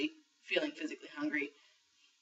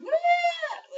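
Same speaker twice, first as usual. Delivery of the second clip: high, loud voice-crack squeal; 11 dB louder than usual. Retold or imitated. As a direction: yelp it high like your voice just cracked. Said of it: "like, really high-pitched, really loud".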